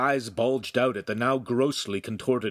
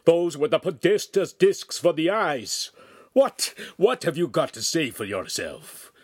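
raised, high-pitched scolding; angry shouting; 8 semitones higher, 5 dB louder than usual. A narrator's reading. Master scolds apprentice over broken lenses, shouting angrily. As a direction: demand an answer, loud and angry. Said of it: "the master shouted".

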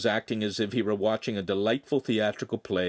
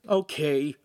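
ordinary delivery, nothing marked

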